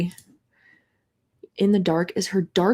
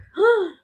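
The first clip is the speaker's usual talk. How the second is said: sharp gasp; 12 semitones higher than usual. Real, not acted. Sees knife on cover.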